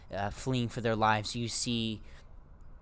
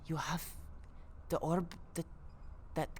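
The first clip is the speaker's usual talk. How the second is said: hushed astonishment; 8 semitones higher, 5 dB quieter than usual. Acted. Orla startled, hushed.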